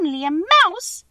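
high, slow, indignant squeak; tiny animal voice, drawn out and affronted; indignant squeaky mouse. A narrator's reading. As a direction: squeak it as an offended little mouse. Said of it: "cried the little animal indignantly".

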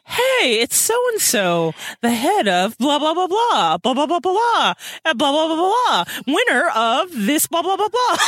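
mock ad announcer rattling off credentials; commercial read. Podcast host mocking a caller's self-introduction as an ad. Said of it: "it almost sounded like a commercial".

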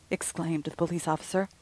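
usual speaking voice

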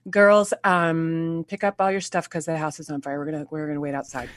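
imitating a super-calm mother; extremely calm. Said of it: "coming in extremely calmly and saying".

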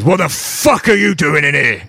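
very angry. Acted. Dwarf guard confronting an intruder at the door, angry challenge. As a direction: challenge the intruder furiously in character. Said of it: "You sound very angry".

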